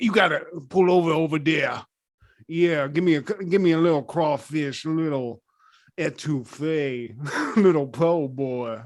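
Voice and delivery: lightly put-on Louisiana accent, mocking Steven Seagal as a cop. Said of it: "putting on, like, a Louisiana accent".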